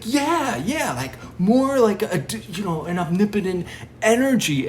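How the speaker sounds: high, excited, stumbling explanation; eager agreement. Retold or imitated. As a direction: seize on his guess, worked up. Retold debate, eagerly agreeing.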